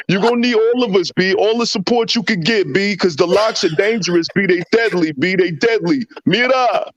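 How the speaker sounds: high-pitched, urgent hype talk; excited street-style warning; hyped rapper's warning. Acted. Acted warning to rapper Cam.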